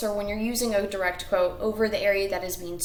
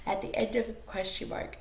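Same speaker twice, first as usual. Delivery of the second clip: tired, softly trailing then apologizing; sleepy slip; 8 dB quieter than usual. Real, not acted. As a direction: let tiredness break into the sentence. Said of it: "I didn't get enough sleep".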